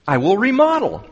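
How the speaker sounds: exclaimed. Acted. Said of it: "he exclaimed".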